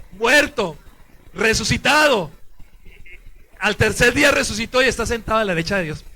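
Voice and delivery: loud, declaimed in separate phrases; creed recitation. Real, not acted. Priest prompting the congregation through the creed, loud recitation. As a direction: call out each article firmly.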